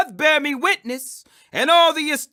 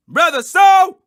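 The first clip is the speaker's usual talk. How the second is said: a loud, higher call of the name; eager loud greeting; 5 semitones higher, 8 dB louder, slower than usual. Acted. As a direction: call to him warmly but loud across the room. Ananias calls out to Saul.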